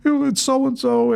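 high, breathless gushing fan voice; gushing star-struck fan. Retold or imitated. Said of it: "all the fans were like you, goo-goo".

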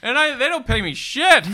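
loud, high mock complaint; hammed-up indignant outburst; comic grievance. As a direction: rant it like an aggrieved employee. Continues the indignant broke rant.

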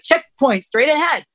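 punchy mock announcement; reading a sign. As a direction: call it out like a road sign. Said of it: "It's like".

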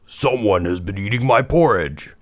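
great big bear voice, crying out; big storybook bear, indignant; Papa Bear. A narrator's reading. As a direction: boom it as a big father bear. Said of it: "cried out in his great big voice".

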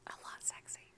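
very soft whisper; whispered aside. Real, not acted. Listener adds a racy aside to the storyteller, whispered. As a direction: slip it in under the breath, whispered.

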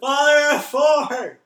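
yelled high, loud and slow; shouting capitalized words. Shown as a demonstration. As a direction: shout the title in all caps. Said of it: "so don't yell it".

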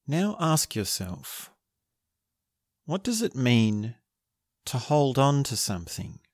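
guided meditation. Acted. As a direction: guide them gently into stillness.

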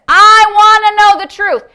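high, loud cry to God; fervent, insistent outcry; impassioned demand. Retold or imitated. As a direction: belt it out like beating a fist. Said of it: "he's beating his fist".

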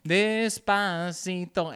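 slow, hammed-up plea. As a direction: stretch out every syllable teasingly. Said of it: "They just started playing fucking Despacito".

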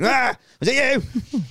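high, drawn-out mock-menacing cry; roar, then demand it like a crazed attacker; Wolverine. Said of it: "like a weird Wolverine, like, going".

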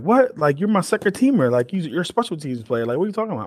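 high-pitched, disbelieving "what?" reaction; incredulous coach. Retold or imitated. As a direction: act out a coach's stunned protest, pitched high.

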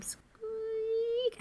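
high squeak imitation; squeaky door noise.

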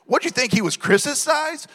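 high, mock-baffled question; incredulous question. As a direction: throw the question back in disbelief. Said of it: "I'm like".

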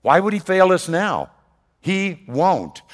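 loud, high, ringing preacher's build; full-voiced pulpit proclamation; preaching at full force. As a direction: proclaim it to the whole congregation.

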